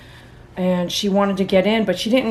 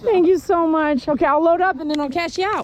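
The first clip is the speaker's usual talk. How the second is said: talking to a dog; 9 semitones higher than usual. Real, not acted. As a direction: thank the dog sweetly. Groomer thanking dog, high voice.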